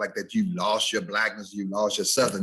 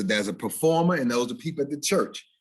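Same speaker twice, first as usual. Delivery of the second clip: usual speaking voice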